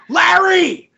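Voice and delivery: put-on high-pitched child's yelp of a name; put-on wronged kid; mock victim cry. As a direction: call out as if your brother just hit you. Child calls out, faking being hit.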